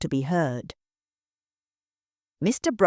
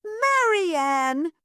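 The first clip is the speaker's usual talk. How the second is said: high, sharp warning call of a name; mother hissing at her daughter; hissed rebuke; 13 semitones higher than usual. Acted. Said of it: "Missus Brockett hissed".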